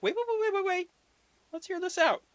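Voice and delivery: high, loud mock-urgent halt; eager interruption. Loki cuts in to keep the plan alive, high urgent interruption. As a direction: burst in high and loud to stop them. Loki excitedly halts the dismissal.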